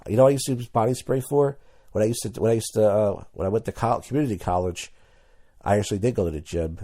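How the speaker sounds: ordinary talk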